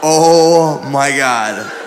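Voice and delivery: dread. Retold or imitated. Client seeing his masseuse come out, slow dread. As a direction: drag it out in dismay.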